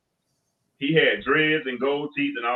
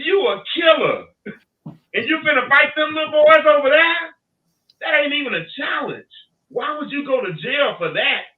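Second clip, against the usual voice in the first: much higher and louder, teasing him hard; fired-up challenge; 13 semitones higher, 6 dB louder than usual. Retold or imitated. To the young fighter at the football game, loud high teasing. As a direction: hype him up, then talk him down.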